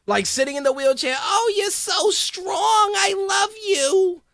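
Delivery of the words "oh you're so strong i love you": put-on high, slow, cracking old-lady voice; old woman. Mocking Heyman as an old woman gushing at Ryback. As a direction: play a frail old woman swooning. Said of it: "like an old woman".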